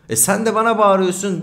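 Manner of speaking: loud, high defensive shout; the officer shouting back in his own defence; retold cop's shout. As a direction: yell it back like the cop did. Cop's reply quoted.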